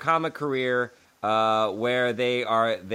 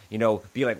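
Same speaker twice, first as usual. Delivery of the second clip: ordinary talk, nothing marked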